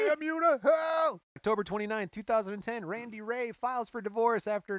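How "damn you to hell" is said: high, loud, slow hammed-up bellow; damn him to hell, full ham; mock furious shout. Topping the bit against Randy, a hammed-up furious shout.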